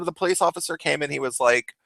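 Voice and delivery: usual speaking voice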